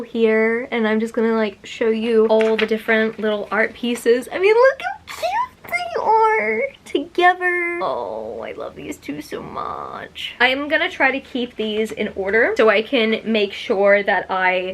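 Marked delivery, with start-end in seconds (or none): 4.3-7.8 high, slow, squealing delight; squealing coo. Real, not acted. Showing the golden couple art, slow high squeal. 7.8-10.3 whispered, slow, low swoon. Real, not acted. Swooning over the golden couple, a slow whisper.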